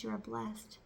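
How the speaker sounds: ordinary delivery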